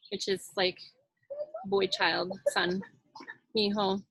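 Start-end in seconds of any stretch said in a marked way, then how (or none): none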